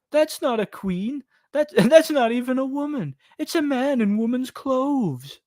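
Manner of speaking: high, slowed cartoon voice; high mock-outraged movie line; quoted movie voice. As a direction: pitch way up like an indignant cartoon character. Quoting Bee Movie line, put-on voice.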